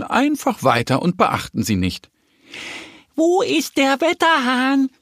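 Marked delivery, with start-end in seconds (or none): 3.2-4.9 a bit louder. A narrator's reading. Little duck asks hens who ignored her, more insistent. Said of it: "fragt Annie etwas lauter".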